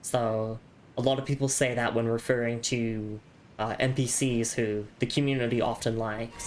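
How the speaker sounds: normal talking voice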